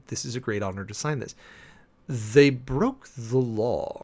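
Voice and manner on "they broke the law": "They broke the law" crawled out word by word; very slow, pointed deadpan. Real, not acted. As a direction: drag it out, exasperated and flat. Deadpan rebuttal, repeated twice.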